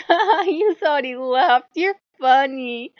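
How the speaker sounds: high, loud, slow smug teasing; gloating wolf. Retold or imitated. The proud wolf taunting gods he chased off, high loud smugness. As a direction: taunt them with a slow triumphant laugh. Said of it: "he's like".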